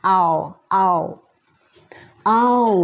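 "I'll" merged into one "เอา"-like syllable; careful one-syllable "I'll", like "เอา"; blended I'll. Shown as a demonstration. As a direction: blend "I" and L into a single sound. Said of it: "รวมเสียงเป็น".

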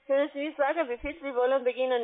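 ordinary talk

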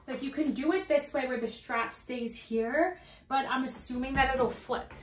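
ordinary delivery

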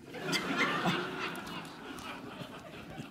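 high breathy burst of laughter; real laugh. Real, not acted. Guest laughing at his own shower story. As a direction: crack up at the memory.

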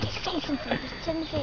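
whispering. Real, not acted. Prompting John in whisper.

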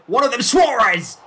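high, loud burst at the chance; excited commentary shout. Real, not acted. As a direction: shout the striker's name as he gets the chance. Calls chance in the box.

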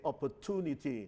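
"opportunity" with a plain T in "tunity"; American pronunciation. Shown as a demonstration. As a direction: say "opportunity" the American way, "-too-nity". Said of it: "คนอเมริกันจะออกเสียง". (ออกเสียง "opportunity" แบบอเมริกัน เป็นเสียง ท "ทูนิตี")

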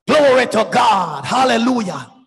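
ordinary talk

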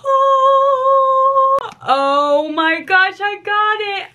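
long high squeal into stretched-out words; real excitement. Real, not acted. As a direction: squeal with joy at getting the gift. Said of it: "I'm so excited for it".

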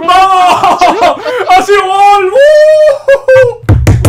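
high, screamed cheers with a whoop; real ecstatic shouting; wild goal celebration. Real, not acted. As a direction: roar it out in disbelieving joy.

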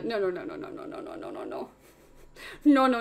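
rapid low chain, fading to a whisper; anxious muttering. Real, not acted. Streamer anxiously watching a summon, muttering under breath. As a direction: let the repeats tumble out, fading away. Dreading what the summon shows.

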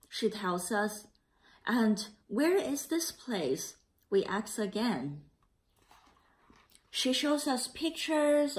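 put-on a gentle read-aloud for children; story narration. A narrator's reading. Teacher reading the class a picture book, gentle narration.

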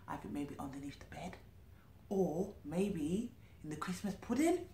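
secret whispered guess. Real, not acted. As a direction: whisper it to the listener.

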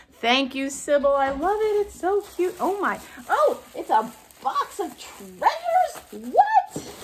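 high, delighted rush building to a squeal; real excitement. Real, not acted. Vlogger thanking Sybil for a box of treasures, excited. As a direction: burst with delight, pitch climbing to the end. Delighted reaction to surprise gift.

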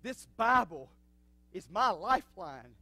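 swelling from strong to a loud shout; building preaching. Acted.

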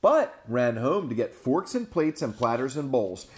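put-on lively read-aloud to children; animated storyteller voice; storybook narration. A narrator's reading. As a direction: tell it big for the little ones. Reading picture book aloud.

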